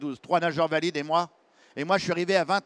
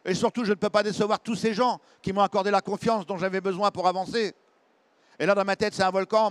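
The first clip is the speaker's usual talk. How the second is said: ordinary delivery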